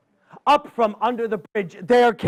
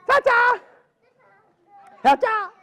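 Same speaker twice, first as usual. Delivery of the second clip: high squeaky two-note squirrel chirp; squirrel chatter; 13 semitones higher than usual. Acted. Squirrel leaving the storekeeper, high chirp. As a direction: chirp it like a squirrel saying thanks.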